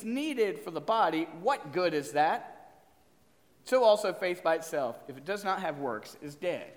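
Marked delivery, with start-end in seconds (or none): none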